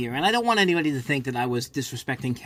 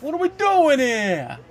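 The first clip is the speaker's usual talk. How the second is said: slow, much higher, louder New York-style exasperated whine; Jalen Hurts impression; 11 semitones higher, 6 dB louder, slower than usual. Streamer mimics a quarterback on the sideline, slow New York disbelief. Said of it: "And Jalen Hurts just like".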